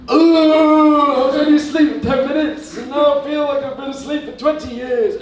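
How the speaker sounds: just woke after ten minutes, whining in a high sing-song; groggy waking voice. Acted. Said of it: "it's like".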